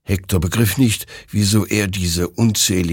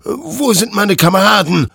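high, loud, agitated threat; raised-voice menacing demand; threatening; 10 semitones higher, 6 dB louder than usual. A narrator's reading. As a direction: confront an enemy with desperate force. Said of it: "drohte Hector".

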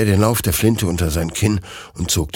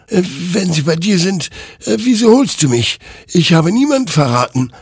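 higher, louder protest; defensive; 12 semitones higher, 6 dB louder than usual. Acted. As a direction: push back hard against the accusation. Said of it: "wehrte sich Hektor". (höher und lauter sich wehrend)

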